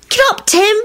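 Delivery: high-pitched coaxing; pleading. A narrator's reading. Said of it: "chimed in pleadingly".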